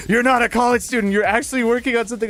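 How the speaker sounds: high, loud mock excitement; hammed-up delighted discovery; retold joyful amazement. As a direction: imitate an overjoyed clerk, speeding up. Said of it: "sparkle of joy in the man's eyes".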